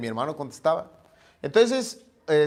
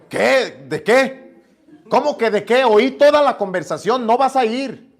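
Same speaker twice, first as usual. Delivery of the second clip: higher and louder, rising over the mother; flustered argument; 7 semitones higher, 8 dB louder than usual. Retold or imitated. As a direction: protest in a rush. Discusión con la mamá. (discusión con la mamá, más agudo y fuerte)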